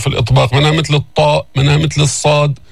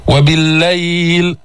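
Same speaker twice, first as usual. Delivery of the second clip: Quran recitation; slower than usual. Shown as a demonstration. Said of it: "قف هنا".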